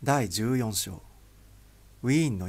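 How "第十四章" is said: plain reading voice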